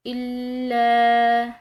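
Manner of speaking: الا with the doubled ل pressed, drawn long; pressed shadda. Shown as a demonstration. Teacher pressing the doubled ل in الا, loud slow chant. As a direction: lean on the ل of الا. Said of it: "تو دباؤ سے ادا کریں گے".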